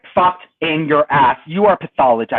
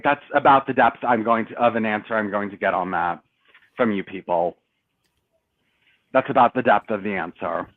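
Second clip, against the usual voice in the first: resigned dismissal; 6 semitones lower, 4 dB quieter than usual. Real, not acted. Gives up on getting real answer.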